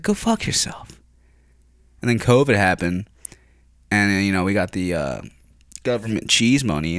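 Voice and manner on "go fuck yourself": hushed go fuck yourself; mock muttered insult; whispered retort. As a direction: throw it away under the breath. Retorting to management.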